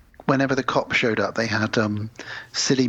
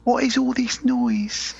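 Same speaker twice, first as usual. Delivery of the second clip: very high, slow mock British; put-on British accent; 11 semitones higher, slower than usual. Host repeating the British girl's line, hammed-up British accent. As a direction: drag out each word in a high British voice. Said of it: "That's my British accent".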